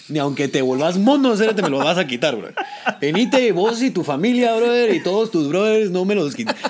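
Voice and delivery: fierce, half-laughing vow that the role is his; defiant loud claim. Real, not acted. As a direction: shout it like nobody will take it.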